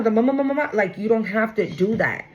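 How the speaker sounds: ordinary delivery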